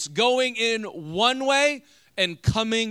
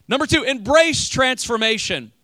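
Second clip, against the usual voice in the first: announcing the point; 5 semitones higher, 7 dB louder than usual. Real, not acted.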